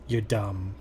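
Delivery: low, quiet deadpan insult; deadpan mock thought. Voicing a supposed private thought to a friend, deadpan. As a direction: toss it off low and under your breath. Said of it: "this is my actual thought".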